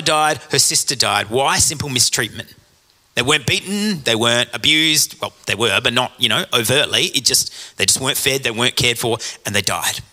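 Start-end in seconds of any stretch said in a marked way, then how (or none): none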